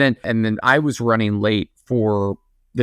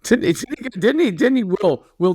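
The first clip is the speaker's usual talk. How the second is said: ordinary talk, nothing marked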